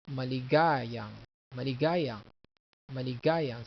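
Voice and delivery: maligayang with GA stressed and lengthened; "maligayang", repeated with a strong GA. Shown as a demonstration. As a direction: lean hard on mali-GA-yang. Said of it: "The accent is here".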